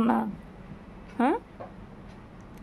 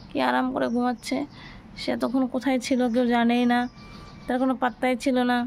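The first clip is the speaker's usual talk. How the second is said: usual speaking voice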